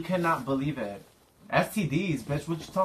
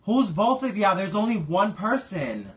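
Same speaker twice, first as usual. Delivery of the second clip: indignant; 7 semitones higher, 4 dB louder than usual. Acted. Prank caller insisting to victim.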